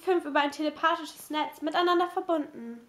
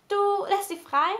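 higher, astonished question; surprised; 5 semitones higher, 4 dB louder than usual. A narrator's reading. Kate sees Anna release the mice, surprised. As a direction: ask in surprise, pitched up.

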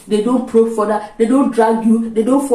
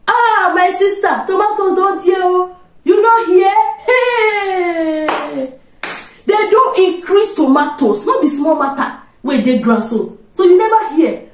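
complaining market seller; 9 semitones higher, slower than usual. Retold or imitated. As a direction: wail about the price like a trader. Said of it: "Let me give you the setting".

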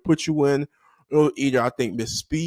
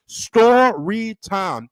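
"story time" stretched slow and higher; drawn-out announcement; 6 semitones higher, 3 dB louder, much slower than usual. Real, not acted. Host announcing the segment to viewers, drawn-out announcement. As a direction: draw out each syllable of "story time". Drawn-out repeat of show title.

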